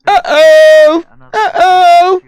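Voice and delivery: very high, loud cartoon squeak; high cartoon squeal of uh-oh; squeaky uh-oh. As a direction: squeal it like a toy creature. Mocking the avatar's sound.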